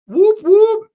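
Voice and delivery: loud high cheering for the guest; cheering whoop. Real, not acted. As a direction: cheer her on like a fan. Cheering for Rose.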